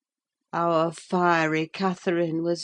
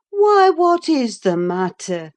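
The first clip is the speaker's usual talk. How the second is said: louder, alarmed call; cried out; 8 semitones higher, 9 dB louder than usual. A narrator's reading. Nelly alarmed by the shriek, calling louder. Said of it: "cried I".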